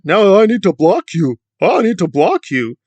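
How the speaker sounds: high, loud mocking voice of Dana; sneering impression of a whiny blocker. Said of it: "Now that he's not doing the propaganda".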